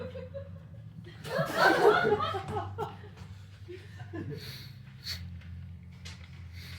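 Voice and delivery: long airy giggle; wheezing giggle fit; breathy laughter. Real, not acted. Cracks up after baseball line.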